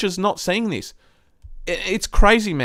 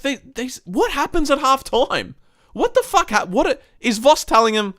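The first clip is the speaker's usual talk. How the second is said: much higher, pitch climbing in outrage; exasperated disbelief; 10 semitones higher than usual. Retold or imitated. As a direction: throw up your hands at the coach, voice cracking high. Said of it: "I'm like".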